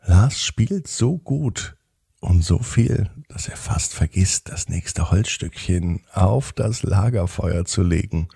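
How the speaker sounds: ordinary delivery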